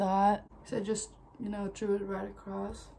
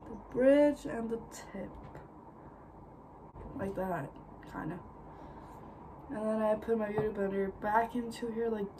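usual speaking voice

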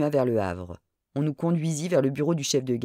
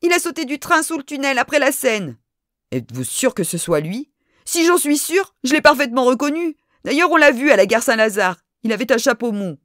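agitated insistence; 7 semitones higher, 5 dB louder than usual. A narrator's reading. Lady's agitated testimony.

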